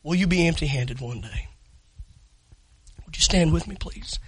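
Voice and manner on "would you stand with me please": usual speaking voice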